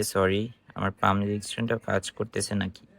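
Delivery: ordinary talk, nothing marked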